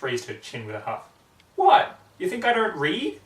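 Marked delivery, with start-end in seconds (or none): 1.5-3.2 much higher, louder offended what; offended pegasus huffing back; indignant outburst. Acted. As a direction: scoff at the insult. Rainbow Dash indignant.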